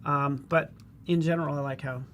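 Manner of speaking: ordinary talk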